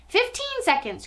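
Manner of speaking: very high-pitched countdown cry; witch's cry. A narrator's reading. Witch counting down over Bruno, crying out. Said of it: "cried the Grand High Witch".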